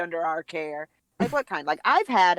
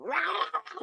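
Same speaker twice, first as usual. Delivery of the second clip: high, softer mock dog roar; imitated dog growl; 10 semitones higher, 8 dB quieter than usual. Owner mimicking her dog's reaction, mock bark. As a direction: go off like a frenzied dog. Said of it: "and she'll just go".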